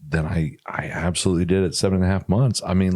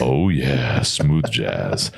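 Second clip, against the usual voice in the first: sexy voice; 7 semitones lower, much slower than usual. Acted. Continues the sexy voice bit.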